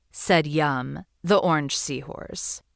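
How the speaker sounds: usual speaking voice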